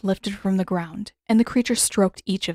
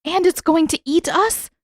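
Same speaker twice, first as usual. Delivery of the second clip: terrified question; 9 semitones higher, 4 dB louder than usual. Acted. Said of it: "voice quaking with terror".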